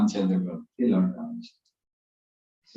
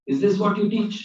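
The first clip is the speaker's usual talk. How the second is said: indignant rebuke; 10 semitones higher than usual. Acted. Principal scolds class teacher.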